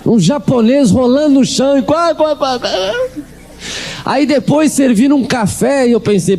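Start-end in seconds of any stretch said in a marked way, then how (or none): 0.0-2.7 imitating a man cackling on the floor; a hammed-up qua qua qua laugh; mock laugh. Storyteller mimicking a laughing man, high cackle.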